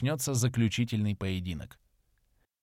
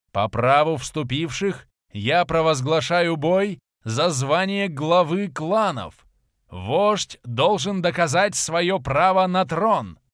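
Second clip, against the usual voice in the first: slow, loud, higher ceremonial call; herald's proclamation; 5 semitones higher, 5 dB louder, slower than usual. A narrator's reading. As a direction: ring it out to the whole arena. Herald announces the duel for chieftainship. (громкое торжественное объявление глашатая)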